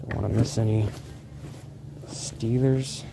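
ordinary delivery

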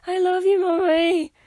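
gushing to mom. Retold or imitated. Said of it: "I'm also like".

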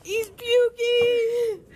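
drawn-out excited shout. Real, not acted. Announcing someone vomiting.

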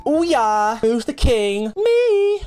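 boastful cheer. Real, not acted. Boasting after winning.

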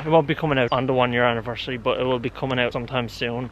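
ordinary delivery

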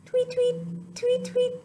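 very high chirpy tweet; high birdy tweeting; bird tweets. Shown as a demonstration. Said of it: "listen to its funny sound".

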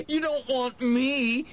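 high, drawn-out tearful wail; sobbing. A narrator's reading. Wendell begs the monster to spare him, sobbing. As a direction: plead through sobs. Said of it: "he sobbed".

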